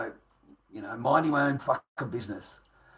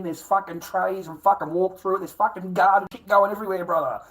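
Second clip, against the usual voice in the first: animated rant; 5 semitones higher, 7 dB louder than usual. Real, not acted. Excited rant about garden work.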